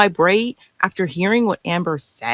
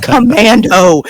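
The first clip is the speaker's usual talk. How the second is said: Commando said loud and slow; loud, slow, relished title; punchy title; 3 semitones higher, 8 dB louder, slower than usual. Real, not acted. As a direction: land the word Commando like a punchline. Emphatic example title.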